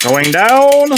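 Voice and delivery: loud. Real, not acted. Pressing the ball joint.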